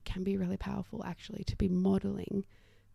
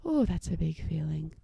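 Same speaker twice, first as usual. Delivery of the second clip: calming co-regulation; 4 semitones lower than usual. Shown as a demonstration. Parent sitting next to overwhelmed child, soothing on sighed breaths. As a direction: let each phrase ride out on a long exhale. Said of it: "model some really big breaths".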